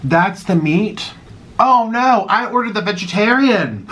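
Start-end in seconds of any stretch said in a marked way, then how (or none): 1.6-3.9 mock dismay. Real, not acted. Realizes she got the veggie patty.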